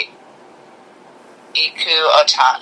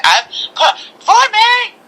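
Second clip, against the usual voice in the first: angry Jigglypuff; slower than usual. Retold or imitated. Imitating mad Jigglypuff scolding sleepers, high squeaky shout. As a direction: squeal at them in a tiny rage. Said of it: "she's like".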